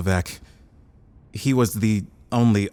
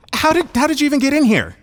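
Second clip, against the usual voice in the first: fast, much higher, louder disbelief; exasperated; 14 semitones higher, 5 dB louder, faster than usual. Acted. Scientist demanding how the intruder got in, exasperated. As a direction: fire off an incredulous question.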